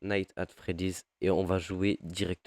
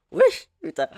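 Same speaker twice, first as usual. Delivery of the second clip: sudden high, loud startled yelp; 10 dB louder than usual. Real, not acted. Player caught off guard by horror game, startled. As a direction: jolt out the words, high and loud.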